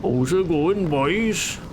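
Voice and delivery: put-on Welsh accent. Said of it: "Thick Welsh accent on them".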